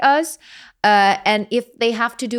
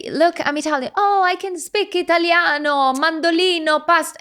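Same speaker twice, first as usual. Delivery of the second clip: hammed-up Italian accent, higher; 8 semitones higher than usual. Mocking the cliché Italian accent of foreigners. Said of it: "And it's like".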